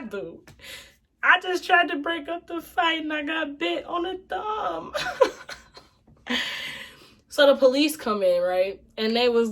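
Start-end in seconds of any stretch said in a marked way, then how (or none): 1.2-6.4 laughing. Real, not acted. Laughing through indignant retelling.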